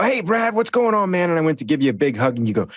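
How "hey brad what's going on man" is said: bright high-pitched hello; excited high greeting. Retold or imitated. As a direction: call out happily, pitched up.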